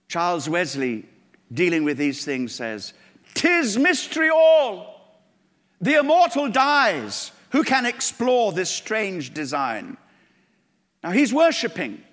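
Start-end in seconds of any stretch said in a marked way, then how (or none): 3.3-10.0 very slow, higher and louder, full of wonder; slow reverent hymn lines; awed hymn recitation. Acted. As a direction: recite it in hushed wonder, then rising. Reciting Wesley hymn in awe.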